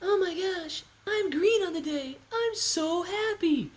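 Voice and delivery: very high falsetto girly impression; Natalie. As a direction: squeal it lightly in a high put-on falsetto. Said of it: "when I'm doing Natalie's voice".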